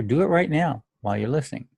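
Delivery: ordinary delivery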